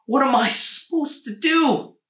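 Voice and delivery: high, loud wail of a poor woman; a desperate peasant woman pleading; distraught woman. A narrator's reading. Poor woman weeping over her stolen pig, high desperate wail. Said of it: "she said".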